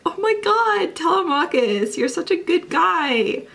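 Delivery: high, swooning gush over a sweet character. Retold or imitated. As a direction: melt and gush, voice shooting up. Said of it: "And I'm just like".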